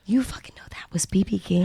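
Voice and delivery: breathless whispered disbelief; whispered amazement. Real, not acted. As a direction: whisper it in awe. Amazed at friend's knowledge.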